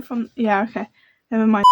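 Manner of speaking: normal talking voice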